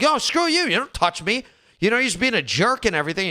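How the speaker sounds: high, fast indignant rant; mock telling off. Imitating himself telling off a pushy guy at a party. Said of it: "I'm like".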